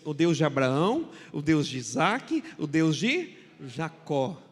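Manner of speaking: slow, loud, rising litany; slow liturgical cadence. Acted. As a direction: chant the patriarchs one by one. Listing the patriarchs. (cadência lenta e solene)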